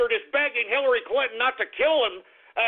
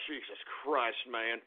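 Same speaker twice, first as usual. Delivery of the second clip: much softer, lower muttered curse; exasperated mutter; 12 dB quieter than usual. Acted. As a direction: mutter it under your breath. Exasperated aside after rant.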